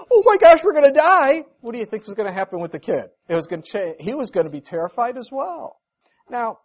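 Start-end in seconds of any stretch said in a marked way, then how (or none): 0.1-1.5 much higher, louder panicked yelp; mock-panicked passenger, higher and louder. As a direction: act out a man panicking on a plane. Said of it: "if the guy goes".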